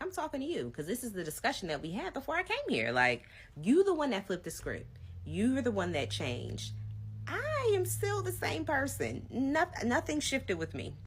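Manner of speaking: high, emphatic, slowing down to insist; rising exasperated pleading; heated high protest. Retold or imitated. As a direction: push every word like you're fed up. Heated retold argument.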